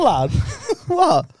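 ordinary talk, nothing marked

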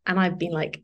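ordinary talk, nothing marked

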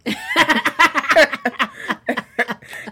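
loud real laugh; burst of laughter. Real, not acted. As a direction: crack up laughing. Laughs at partner's teasing.

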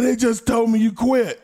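high, loud mock yell; Chris Benoit yelling. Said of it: "He said".

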